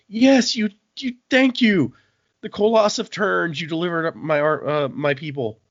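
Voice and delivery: high, loud, jubilant gratitude; mock overjoyed thanks. Yeoman thanking his rescuer, hammed-up jubilation. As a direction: gush thanks like a hammed-up believer, easing off.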